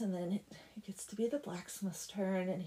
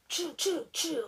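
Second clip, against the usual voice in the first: higher, imitated switch strokes; whip-swish noises; 7 semitones higher than usual. Blacksmith switching the devil's back, imitated swishes. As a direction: make three quick swishes of a switch. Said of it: "he gets a switch".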